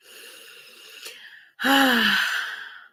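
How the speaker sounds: long, loud exhaled sigh; big sigh. Real, not acted. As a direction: let out a heavy breath. Sighs after tribute to father.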